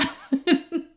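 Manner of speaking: short high laugh at the memory. Real, not acted. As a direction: laugh it off, amused. Laughs after mother's story. (risa breve y aguda)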